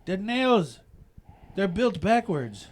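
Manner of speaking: high, loud and slow protest; insist, loud and drawn out; indignant complaint. Retold or imitated. Worker complaining about the nails, loud and slow. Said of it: "He says".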